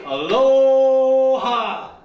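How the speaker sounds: long, drawn-out loud greeting; big aloha. Real, not acted. Comic greeting the whole crowd with a long, loud call. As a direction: stretch it out to the back row.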